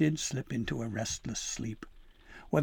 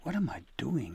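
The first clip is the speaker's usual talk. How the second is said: hushed, voiceless worry; frightened whisper. Acted. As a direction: whisper it, scared to be found. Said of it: "in fear".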